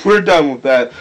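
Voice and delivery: ordinary talk, nothing marked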